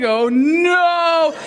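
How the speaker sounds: loud, long drawn-out NO; slow-motion cry. Acted. Watching her own fist fly in slow motion, wailing. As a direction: stretch the horror out like slowed film. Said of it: "I saw this happen in slow motion".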